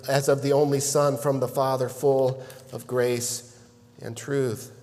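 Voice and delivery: soft, low reading of scripture. Acted. Pastor reading John to his congregation, soft and reverent. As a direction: read the verse quietly and reverently. Reads scripture softly and slowly.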